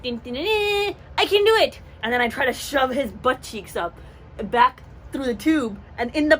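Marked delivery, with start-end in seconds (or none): 0.0-1.8 high, quick pep chant; mock heroic self-encouragement; higher and louder hype-up; psyching herself up. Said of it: "I'm like".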